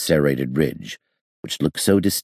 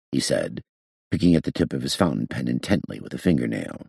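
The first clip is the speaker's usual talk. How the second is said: ordinary delivery, nothing marked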